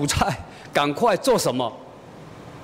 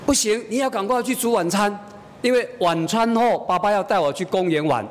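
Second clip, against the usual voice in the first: put-on little girl; 6 semitones higher than usual. Daughter demands dinner early.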